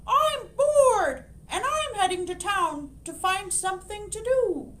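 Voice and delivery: high, loud squawky hen voice; squawking hen. Acted. Bored hen announcing she is heading to town, squawking. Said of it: "the hen squawked".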